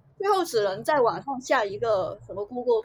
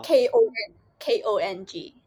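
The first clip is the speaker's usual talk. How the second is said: letter by letter, slowly; spelling it out; 4 dB louder than usual. Shown as a demonstration. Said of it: "讲怎么拼音啊".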